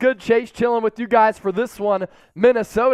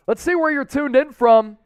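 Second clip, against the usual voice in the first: calling out; 4 semitones higher, 6 dB louder than usual. Real, not acted. Host calls out to viewers.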